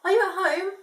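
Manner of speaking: high loud call into a hole. Acted. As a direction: call out to the possum. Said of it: "Cheeky cried out".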